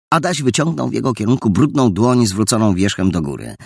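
ordinary delivery, nothing marked

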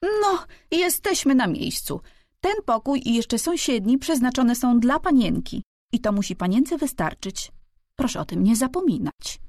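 high-pitched, bossy housekeeper voice; unceremonious housekeeper. A narrator's reading. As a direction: lay down the rules without ceremony, pitched high. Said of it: "odezwała się bez ceremonii".

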